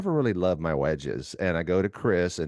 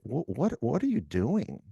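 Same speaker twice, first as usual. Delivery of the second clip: higher, stammering disbelief; mock bafflement; 6 semitones higher than usual. Golf partners asking about his new technique, baffled. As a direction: imitate stunned onlookers, voice pitched up. Retelling people's baffled reactions.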